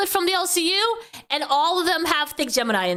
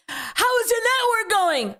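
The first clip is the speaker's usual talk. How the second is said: mocking voice; 7 semitones higher than usual. Mocking the other man's question.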